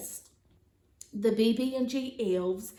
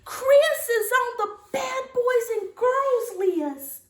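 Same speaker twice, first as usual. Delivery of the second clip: high loud cry of dismay; cried out; 11 semitones higher, 5 dB louder than usual. Acted. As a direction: cry it out, higher and louder. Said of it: "she cried".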